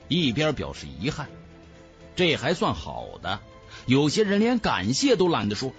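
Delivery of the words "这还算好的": ordinary delivery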